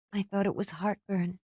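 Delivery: normal talking voice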